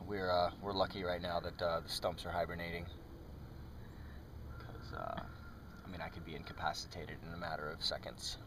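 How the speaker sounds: low, hushed wildlife host; hushed documentary narration. Pretend. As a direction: narrate like a nature show in hushed tones. Mock nature documentary host.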